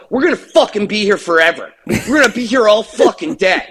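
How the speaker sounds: high, fast mock panic; hammed-up fretting, pitch up; imitated freak-out. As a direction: rattle it off as an exasperated overreaction. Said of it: "in typical rich freak out mode".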